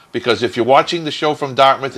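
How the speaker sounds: ordinary talk, nothing marked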